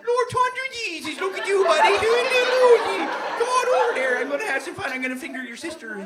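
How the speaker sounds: high, loud, over-friendly Newfoundlander; Newfoundland party accent. Acted. East Coaster hails a buddy, rowdy Newfoundland accent. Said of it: "they got such a party accent".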